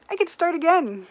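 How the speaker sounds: delighted about the restart; excited. Real, not acted. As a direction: cheer it like good news. Restarting the game gleefully.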